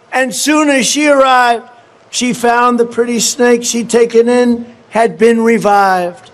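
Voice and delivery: measured storytelling read, slowing on the snake; a slow, dramatic poem read; poem recitation. Acted. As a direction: recite it like a fable to the crowd. Continues reading the poem.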